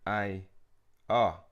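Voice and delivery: ordinary talk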